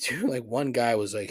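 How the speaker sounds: ordinary delivery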